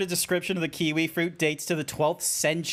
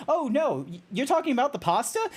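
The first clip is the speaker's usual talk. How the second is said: mock surprised Canadian; 7 semitones higher than usual. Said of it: "you then hear from Canada".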